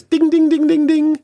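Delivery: high, sing-song bell imitation; ding ding bell. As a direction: chime it like a quiz bell. Correct-answer game show bell.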